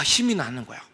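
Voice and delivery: normal talking voice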